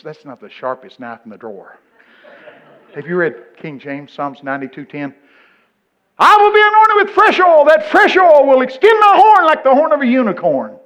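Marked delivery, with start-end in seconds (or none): 6.2-10.8 high, loud comic declaiming; mock-grand proclamation. Preacher reciting King James verse about unicorn, hammed-up shouting. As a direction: bellow the verse at the top of your range.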